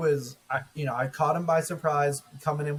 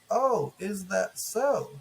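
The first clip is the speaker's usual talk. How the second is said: slow, drawn-out, playfully innocent; mock coy question; 6 semitones higher, much slower than usual. Retelling his reply to a friend's text, slow mock coy. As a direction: act coy as if surprised by the news. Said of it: "And I was like".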